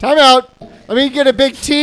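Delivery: high, loud time-out call. Pretend. Playing bride calling time out.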